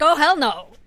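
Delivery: loud, flat-out "hell no"; no way, not happening; emphatic refusal. Retold or imitated. Mother refuses lying back.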